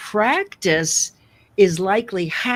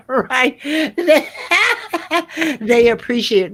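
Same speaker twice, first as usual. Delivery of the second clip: talking through real laughter, higher pitched; laughing; 7 semitones higher, 4 dB louder than usual. Real, not acted. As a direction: laugh your way through the words, voice lifting. Laughing at own sarcasm remark.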